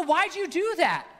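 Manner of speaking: high-pitched mock disbelief; retold scolding. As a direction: demand an answer from a naughty nephew. Uncle scolding nephew, retold.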